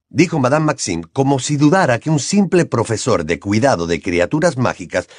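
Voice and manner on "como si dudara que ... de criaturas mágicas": ordinary delivery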